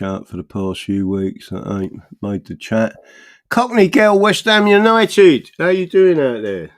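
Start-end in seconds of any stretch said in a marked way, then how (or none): 3.5-6.7 loud, high, bright hello to a fan; big cheery greeting, much louder and higher; excited shout-out. Real, not acted. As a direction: call out to her like across a pub. Greeting a viewer in chat.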